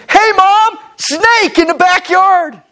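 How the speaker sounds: put-on high excited kid yell, slow; child calling mom about a snake; child screaming. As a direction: shout it like a kid who found a snake. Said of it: "one of them screams".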